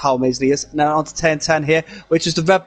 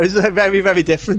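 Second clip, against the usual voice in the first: normal talking voice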